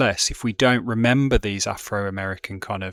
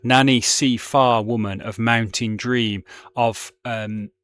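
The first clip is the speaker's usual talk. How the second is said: louder rhythmic reading with Caribbean-dialect words; poetry reading in dialect; 5 dB louder than usual. Shown as a demonstration. Teacher reading the last verse to the class, in rhythmic dialect. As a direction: lean into the list with emphatic beats. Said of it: "I will just read it in my, my Brummie accent as best I can".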